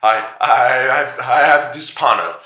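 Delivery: high, stammering panic; frightened, tripping over words; backing away in terror; panicked. Acted. Panicked priest backs away.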